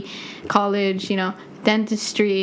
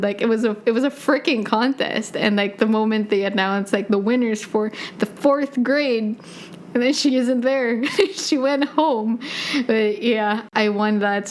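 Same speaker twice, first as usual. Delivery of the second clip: normal talking voice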